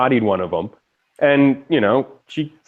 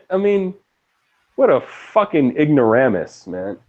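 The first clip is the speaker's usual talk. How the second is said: ordinary delivery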